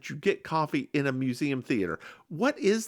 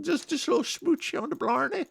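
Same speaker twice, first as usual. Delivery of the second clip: higher, hammed-up Irish brogue; bad Irish accent; 11 semitones higher than usual. Teasing her cohost in a bad Irish accent. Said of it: "I can do an Irish accent".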